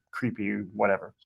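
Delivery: normal talking voice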